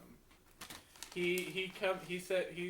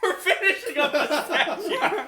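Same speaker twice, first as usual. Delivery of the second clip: slow, loud, laughing mock reassurance; fake-soothe the backers; reassuring; 10 semitones higher, 14 dB louder, slower than usual. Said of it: "sounding like".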